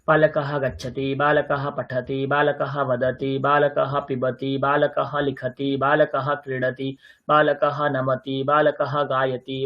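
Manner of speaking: steady, monotone chant-like rhythm; sentence-drill recitation. Shown as a demonstration. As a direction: recite each pair evenly on one pitch.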